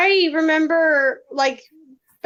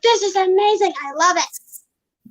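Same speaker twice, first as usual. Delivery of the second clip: imitating her younger self, higher and thrilled; retold excitement; 5 semitones higher than usual. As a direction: replay the moment you loved the movie. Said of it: "I was like".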